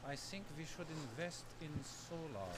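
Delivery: put-on slow, menacing German accent; a terrifying German scientist, slow; a German scientist recommending solar, slow and menacing. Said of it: "German accent so I can".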